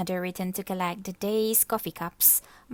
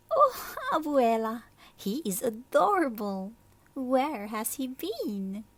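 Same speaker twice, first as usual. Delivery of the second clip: smitten; 5 semitones higher than usual. Acted. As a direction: swoon over him. Martina smitten.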